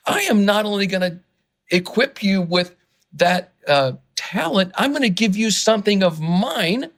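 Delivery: real excitement, pitch riding high; worked up. Real, not acted. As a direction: pour it out with mounting conviction. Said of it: "I'm getting a little worked up".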